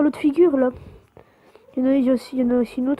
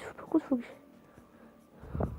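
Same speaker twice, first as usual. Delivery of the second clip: whispering; 14 dB quieter, much slower than usual. Real, not acted. Racing to catch up.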